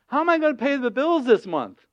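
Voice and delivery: high, fretting question; hammed-up worried plea; acted worry. Voicing an anxious person's worry.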